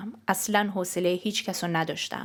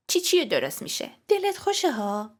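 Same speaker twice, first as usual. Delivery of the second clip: high, incredulous retort; exasperated rhetorical question, pitched up; scornful echo; 8 semitones higher than usual. Acted. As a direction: throw the reassurance back in disbelief. Sister scornfully throws the words back.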